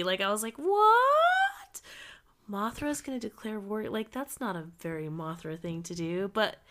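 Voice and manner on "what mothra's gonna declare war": high, loud squeak of disbelief; mock outrage at the news; shocked disbelief. Retold shocked reaction.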